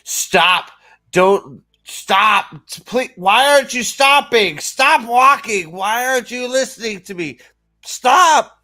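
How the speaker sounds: hammed-up helpless shouting at someone who won't stop; mock frantic yelling. Mocking Jedi yelling after her, hammed-up frantic shouts. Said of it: "are back there going".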